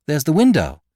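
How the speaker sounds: desperate cry toward the window; urgent cry. A narrator's reading. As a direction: call it out in alarm. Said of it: "cried Beautrelet".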